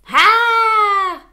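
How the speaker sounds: high, loud terrified shriek; terrified scream. Acted. The startled big wolf screams back at Wolf, high terrified shriek. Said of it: "répondit la créature terrorisée".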